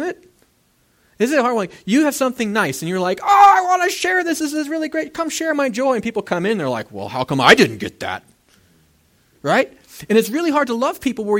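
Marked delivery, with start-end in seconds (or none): 3.2-5.9 high-pitched, gushing delight, loud at the start; mock-excited, pitch way up; excited sharing. Acts out excitedly sharing good news. 6.9-8.2 lower, loud mock complaint; envious grumble. Acts out envious onlookers' complaint.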